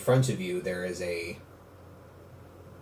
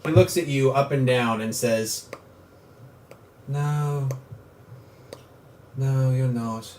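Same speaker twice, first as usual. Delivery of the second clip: a calm dark lord judging the hero; sinister villain. Acted. GM voices villain at length.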